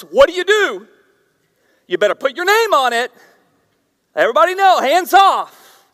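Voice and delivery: high, loud mock territorial claim; loud playful warning. Real, not acted. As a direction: warn coworkers off your pizza. Office pizza comic warning.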